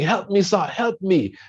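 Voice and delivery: high, begging cry to the sir; mock scream for help. Man in a ditch begging the third passerby, high pleading cry. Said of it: "this guy was screaming".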